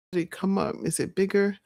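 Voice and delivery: ordinary talk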